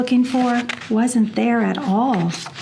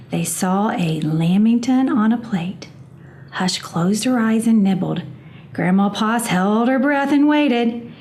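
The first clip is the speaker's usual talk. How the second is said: ordinary delivery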